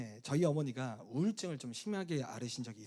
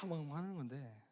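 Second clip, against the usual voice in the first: ordinary delivery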